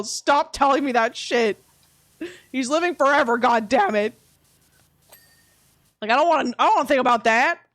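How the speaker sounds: loud, fed-up protest; exasperated protest. Real, not acted. Protesting talk of putting dog down.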